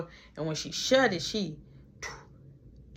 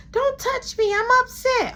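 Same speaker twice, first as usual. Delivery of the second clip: put-on very high, loud childish voice; squeaky high upset kid; upset girl voice; 9 dB louder than usual. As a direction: play a sulky young girl.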